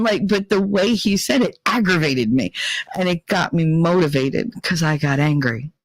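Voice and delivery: ordinary delivery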